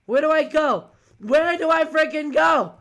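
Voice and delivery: loud, high cry of alarm; panicked yell. Real, not acted. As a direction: yell it, desperate for a way out. Panics over where to run in game.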